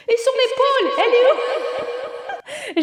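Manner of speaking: high, drawn-out mock surgeon through a laugh; TV doctor. Mother playing Grey's Anatomy doctor in delivery, laughing mock voice. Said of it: "J'étais en, en Grey's Anatomy".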